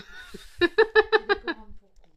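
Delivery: loud high burst of laughter; high laughing fit. Real, not acted. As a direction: laugh until out of breath.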